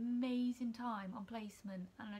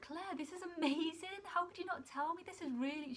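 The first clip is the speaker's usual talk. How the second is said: shocked mentor; 7 semitones higher than usual. Retold or imitated. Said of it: "she was really shocked".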